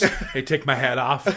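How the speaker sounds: ordinary talk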